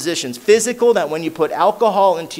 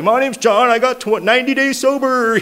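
higher, louder, lightly mocked old sober guy; old-timer on a walker; 8 semitones higher, 4 dB louder than usual. Guy on a walker proudly introducing himself, mildly mocking, higher and louder. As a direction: poke fun at the proud old-timer with a raised voice. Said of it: "he's like".

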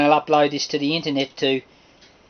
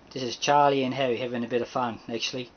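ordinary talk, nothing marked

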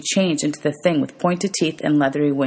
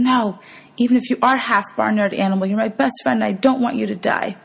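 desperate plea; 6 semitones higher than usual. A narrator's reading. Hero's desperate thought for injured friend.

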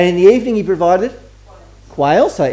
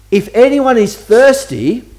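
raised, higher-pitched slow proclamation; retold loud call; 6 semitones higher, 4 dB louder, slower than usual. Preacher quotes Jesus at the feast, loud call. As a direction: call it out slowly, a half-yell. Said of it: "yelled in a loud voice".

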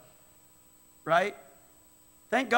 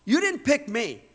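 ordinary talk

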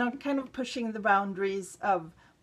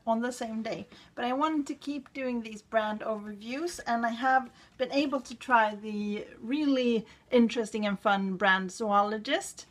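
normal talking voice

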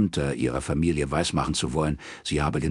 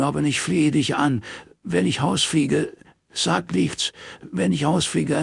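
sobbing plea; 9 semitones higher than usual. Acted. Morris begging Robin not to tell, sobbing desperately. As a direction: beg through dry sobs. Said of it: "Sturm trockener Schluchzer".

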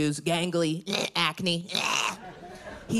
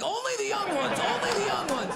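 much higher, picky call to the workers; calling out only the young ones, pitched way up; mock boss call; 12 semitones higher than usual. Contractor at Home Depot picking workers, high mock call. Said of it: "I have to be like".

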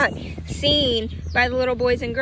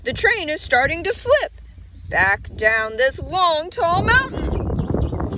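alarmed flat car; 5 semitones higher than usual. A narrator's reading. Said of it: "called the flat car".

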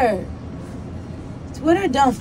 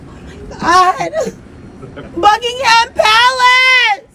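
"Buckingham Palace" slow, high and louder, drawn out; excited; 8 semitones higher, 3 dB louder, slower than usual. Shown as a demonstration. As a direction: savour the words like a dream come true. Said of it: "But Buckingham Palace".

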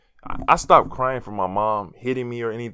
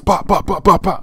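punch sound effects; 9 semitones higher, 9 dB louder than usual. Retold or imitated. Imitating punches landing.

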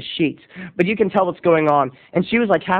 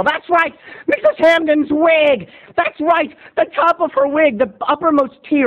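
excited; 7 semitones higher than usual. Real, not acted. Excited storytelling climax.

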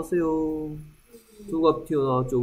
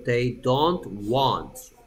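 very slow, each word spelled out; drawn-out teaching model; slow demo; 3 semitones lower, much slower than usual. Shown as a demonstration. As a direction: say it slowly for the class to repeat. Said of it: "teacher demo, slowed for learners".

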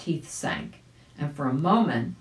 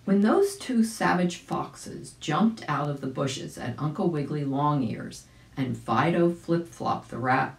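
usual speaking voice

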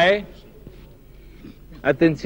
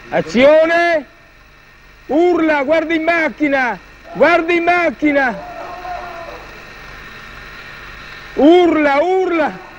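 shouted directions; 10 semitones higher, slower than usual. Real, not acted. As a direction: bark the commands across the set. Director shouting during the take.

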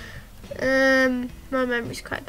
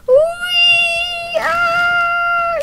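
squealing wee of delight; excited whoops; much slower than usual. Real, not acted. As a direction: whoop as the body flies. Ragdoll flying through the level.

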